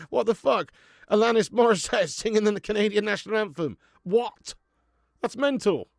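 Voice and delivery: loud, high, incredulous outburst with laughter; amused disbelief. Real, not acted. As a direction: exclaim it, astonished and tickled. Laughing disbelief at the anthem singer.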